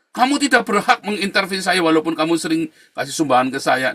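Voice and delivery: loud, high-pitched scolding; heated quoted protest; angry rebuke. Retold or imitated. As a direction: act out an indignant telling-off at full volume. Re-enacted quarrel over donations.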